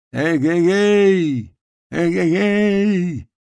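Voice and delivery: long, high drawn-out halloo; calling out. A narrator's reading. Climber shouts up from the foggy slope, calling out. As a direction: holler across a distance, stretching the last vowel. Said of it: "Потом Ньюстед закричал".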